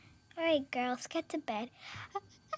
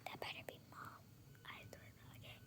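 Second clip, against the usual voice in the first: whisper; 30 dB quieter, much faster than usual. Acted. Hiding from a scary caller.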